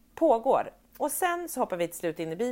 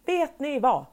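fed-up outburst; 5 semitones higher, 7 dB louder, much slower than usual. Retold or imitated. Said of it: "jag bara".

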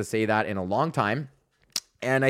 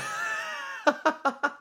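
very high shouted laugh; shrieking laugh; 5 dB quieter than usual. Real, not acted. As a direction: cackle in disbelief. Laughs at Normandy joke.

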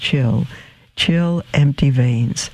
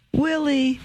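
higher and softer, breaking with joy; his name again, overcome and tender; rising joy; 12 semitones higher, 5 dB quieter than usual. Acted. Mother embracing her son, higher, softer, overjoyed.

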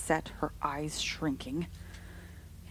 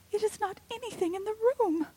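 high, firm and frightened; fearful insistence. A narrator's reading. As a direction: deny it in dread. Rebecca insisting.

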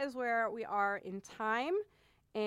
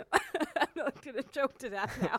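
choking laugh; 3 semitones higher than usual. Real, not acted. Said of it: "I'm still choking on my Starbucks".

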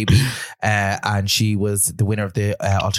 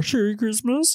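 pun sung out much higher; high punchline; 12 semitones higher, slower than usual. Acted.

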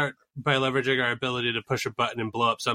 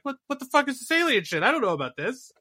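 much higher, startled Trump impression; mock outraged Trump; 12 semitones higher than usual. Trump fuming that nobody told him, mock outraged. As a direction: squawk it like he was left out. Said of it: "he's just like".

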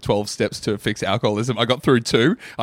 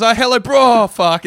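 louder, higher mock greeting cut off by a curse; mock group member; 9 semitones higher, 8 dB louder than usual. Co-host joining the joke as everyone at the meeting, loud mock greeting. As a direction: play a support-group member blurting hello. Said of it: "everyone goes".

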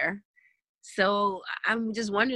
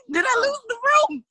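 laughing; 5 dB louder, faster than usual. Real, not acted. Laughs after an unpopular opinion.

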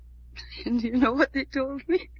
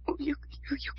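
breathless whisper; 11 dB quieter, much faster than usual. Acted. Stunned whisper.

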